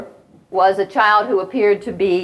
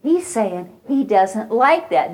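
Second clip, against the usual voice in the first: gentle teacher voice; 2 semitones higher, slower than usual. Shown as a demonstration. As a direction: coax the child gently and slowly. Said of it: "and you need to say".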